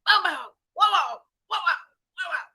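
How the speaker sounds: loud, high dog barks; barking dog. Acted. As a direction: bark hard while chasing. Dog barking while chasing.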